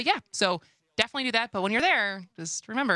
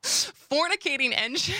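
laughing; 8 semitones higher than usual. Real, not acted. Laughing while reading the term.

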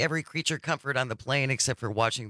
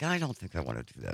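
low, soft backing-out aside; understated, lowered voice of second thoughts; retold reluctance; 9 semitones lower, 6 dB quieter, faster than usual. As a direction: quietly back away from the idea. Retelling balking at the bungee edge.

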